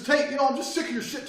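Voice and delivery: angry guy yelling at Tate. Retold or imitated. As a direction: shout at Tate to provoke him. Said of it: "He goes".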